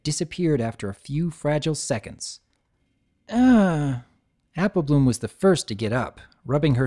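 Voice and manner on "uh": dazed groan. Acted. Dazed groan after the flash.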